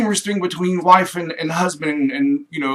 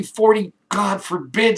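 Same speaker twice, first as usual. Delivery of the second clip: usual speaking voice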